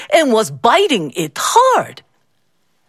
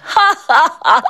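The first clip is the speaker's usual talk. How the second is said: mocking laugh; 7 semitones higher than usual. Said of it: "burst out laughing".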